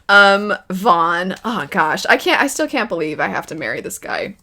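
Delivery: ordinary talk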